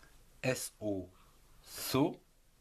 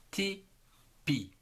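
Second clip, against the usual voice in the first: "Tipi" in two separate, higher beats; syllable by syllable; 8 semitones higher than usual. Shown as a demonstration. Teacher assembling spelled syllables, "Tipi" broken into ti and pi. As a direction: split "Tipi" into ti, pause, pi. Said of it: "T I, ti".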